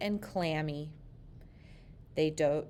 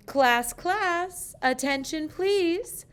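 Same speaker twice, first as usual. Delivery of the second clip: much higher, louder sing-song call; teacher calling class; 11 semitones higher, 6 dB louder, slower than usual. A narrator's reading. Principal quieting the classroom, high and loud. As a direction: call the room to order, high. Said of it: "said Missus Burton".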